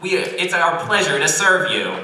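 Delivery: high, loud customer-service greeting; cheery robot cashier. Acted. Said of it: "this smiling, cheery robot cashier".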